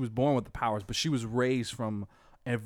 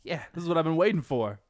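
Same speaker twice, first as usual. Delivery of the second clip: eager; 4 semitones higher than usual. Retold or imitated. Wonder Woman gets permission to fight, eager and quick. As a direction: finally let loose, gleefully. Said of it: "and she's like".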